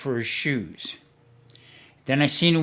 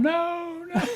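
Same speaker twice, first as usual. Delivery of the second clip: high, drawn-out "no"; mock wailing objection; imitating a pleading no. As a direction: mimic a long high-pitched protest. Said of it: "Marie's going".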